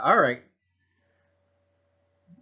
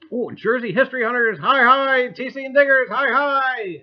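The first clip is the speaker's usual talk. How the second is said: excited greetings; 11 semitones higher, 12 dB louder than usual. Real, not acted. Greeting chat viewers loudly.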